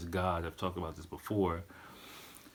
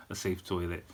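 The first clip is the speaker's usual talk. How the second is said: normal talking voice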